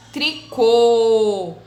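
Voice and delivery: tricô with a long, loud CÔ; stressed syllable stretched. Shown as a demonstration. Teacher modelling circumflex words, tricô with CÔ drawn out. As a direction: hold the closed ô of tricô loudly. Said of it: "a sílaba mais forte".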